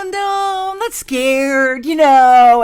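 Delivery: high, loud, drawn-out protest; over-protest loudly, acting out your own denial; hammed-up defensive wail; indignant mock denial. Retold indignant denial to friend.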